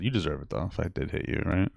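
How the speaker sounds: ordinary talk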